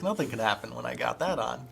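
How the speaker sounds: ordinary talk, nothing marked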